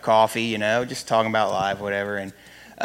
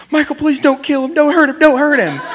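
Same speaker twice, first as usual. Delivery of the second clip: much higher and louder, frantic mother's plea; freaking-out mom; 9 dB louder than usual. Retold or imitated. Mother begging her son not to hurt the intruder, frantic. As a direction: beg like a panicked mom. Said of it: "she freaks out about everything".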